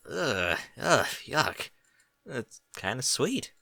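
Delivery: soft and slow, torn between disgust and delight; confused whisper. A narrator's reading. Sparkler watching her mother, soft confused murmur. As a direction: murmur it, unsure what to feel. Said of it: "in a confused whisper".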